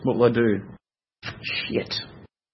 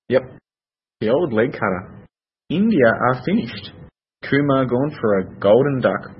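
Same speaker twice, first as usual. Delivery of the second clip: ordinary delivery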